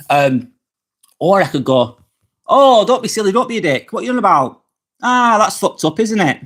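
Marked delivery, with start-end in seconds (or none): 2.5-6.4 higher-pitched, good-natured ribbing with no nasty edge; harmless, playful swearing. Shown as a demonstration. Showing viewers that swearing can be friendly, playful swearing. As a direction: tease with swear words, no malice behind them. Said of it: "I don't have any energy, nasty energy".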